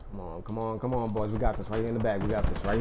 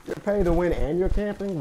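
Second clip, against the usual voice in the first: incredulous; 9 semitones higher than usual. Real, not acted. Mocking camping opponent.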